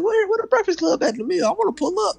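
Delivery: high, fast retold question; eager mock self-quote. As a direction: pitch it up and rush it, quoting yourself. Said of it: "I was like".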